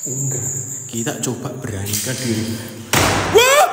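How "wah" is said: sudden high yell of fright; startled shout. Real, not acted. Explorer yelling when something startles him. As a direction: yell in shock.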